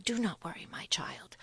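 whispered, like sharing a secret. Acted. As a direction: lean in and whisper it to the girl. Old woman reveals secret kingdom.

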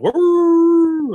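very high loud howl; wolf howl. Acted. Wolves howl.

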